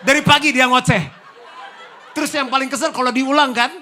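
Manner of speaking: high, loud laughing exclaim; laughing shout. Real, not acted. Preacher laughing at nagging husbands, loud laughing shout.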